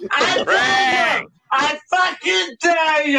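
drawn-out shouted dare; angry challenge. Real, not acted. Daring a heckler to come round, angry shouting. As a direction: shout it as a real, furious challenge.

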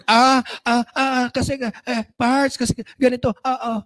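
flustered, tripping over ah and kasi; stammering. Acted. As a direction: caught off guard and stuttering the excuse. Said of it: "Utal-utal ka na".

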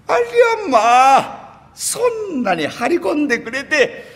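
put-on high, slow old granny gasp; drawn-out amazed old lady; an old woman marvelling at the price offered; surprised old woman.

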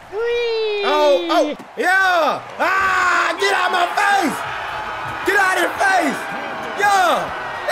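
high, full-voiced hype shouts; triumphant yelling. Real, not acted. Gamer yelling get out my face after a play, triumphant. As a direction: yell in the opponent's face.

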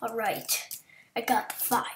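hushed unvoiced whisper throughout; soft whispered muttering to self. Real, not acted. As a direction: whisper it like someone's asleep nearby. Whispering through chat fix.

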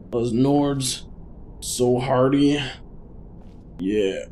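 ordinary talk, nothing marked

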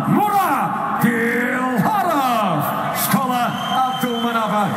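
slow, booming stretched name; drawn-out winner call. Real, not acted. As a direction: hold every syllable for the crowd.

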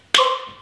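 high squeaky mock pop; balloon pop noise. Imitating a balloon popping. (magas, játékos lufidurranás-hang)

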